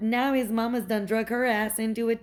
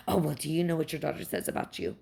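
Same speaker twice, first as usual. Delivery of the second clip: lower, quicker, quieter snide imitation; snide mock voice; 5 semitones lower, 3 dB quieter, faster than usual. Imitating the ex's mother's smug dig, low and quick. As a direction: mimic her smug jab, low and fast.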